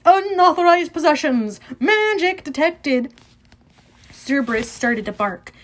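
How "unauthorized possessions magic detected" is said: high, loud machine warning; screaming alarm. A narrator's reading. Metal detector catches Percy and friends, screaming an alarm. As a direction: blare it out, slowing on the second warning. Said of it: "which immediately screamed".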